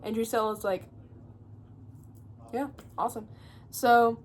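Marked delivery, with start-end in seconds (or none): 2.5-3.3 soft, deadpan fake enthusiasm; dry sarcastic yeah. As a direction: say it bored and unimpressed. Drusilla's sarcastic reply.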